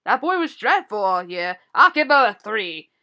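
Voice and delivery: a lightly voiced Santa in a hammed-up British accent; bad British accent Santa. Santa judging a boy who was dreadful, in a mock British accent. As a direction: play a mall Santa with a clumsy British accent. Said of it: "And my worst British accent".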